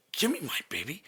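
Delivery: rasping whispered demand; the mother at the door, whispered and curt; imitated whisper. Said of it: "she goes".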